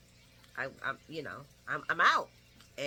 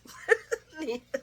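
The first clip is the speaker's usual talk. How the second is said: laugh; 7 semitones higher than usual. Real, not acted. Laughing at her own impression.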